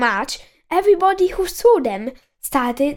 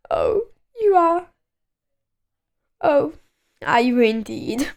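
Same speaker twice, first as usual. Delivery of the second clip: sniffy miserable man; 2 semitones higher than usual. A narrator's reading. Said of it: "sniffed the person".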